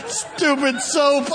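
slow, wheezing laughter over the words; laughing hard. Real, not acted. Preacher repeating the punchline, helpless with laughter. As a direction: choke it out through laughter. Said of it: "Boy, I'm crying".

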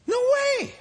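very high squealed protest; incredulous, sky-high denial; emphatic high exclamation. Real, not acted. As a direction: leap right up into a squeal.

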